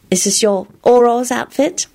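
high-pitched coy question; sly. A narrator's reading. Elizabeth teasing Lupin about his costume, slyly. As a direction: ask slyly, testing him. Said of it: "Elizabeth asked him slyly".

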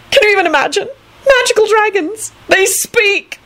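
very high, breathless gushing; awed excitement. A narrator's reading. Antonin gushing about dragons, thrilled.

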